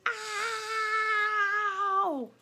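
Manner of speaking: sharp shrill ow of pain; a rat's pained scream; high pained yelp. A narrator's reading. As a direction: yelp as if your tail was cut off. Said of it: "screamed Roscaro".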